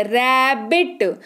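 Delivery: rabbit stretched out slowly; slow model word. Shown as a demonstration. Teacher models rabbit for students, slow and clear. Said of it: "पहला word है".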